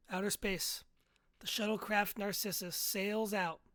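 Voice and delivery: ordinary talk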